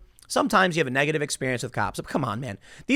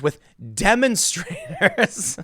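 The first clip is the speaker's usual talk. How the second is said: slow mocking drawl on demonstrators, laughing; sarcastic drawl; 4 semitones higher, much slower than usual. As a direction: sneer the word demonstrators slowly with a laugh.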